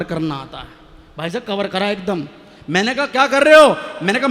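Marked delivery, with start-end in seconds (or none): 3.1-3.8 loud, mock-angry wife's challenge; mock angry demand. Wife catching him hugging the neighbour, loud mock demand. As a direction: bark it like a caught-out wife.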